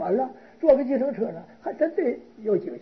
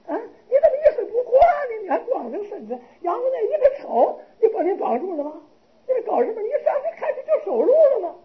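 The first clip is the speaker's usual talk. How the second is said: high, shrill-edged princess near tears and laughing; a princess half scolding, half pitying, pitched high; 8 semitones higher, 3 dB louder than usual. Acted. Princess scolding the naked bound monk, high and half laughing.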